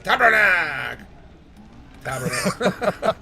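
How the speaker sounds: stretched-out Quebecois curse; angry Quebecois accent. Acted. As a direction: swear like a cross Quebecer, dragging the vowels. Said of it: "Quebecer angry accent".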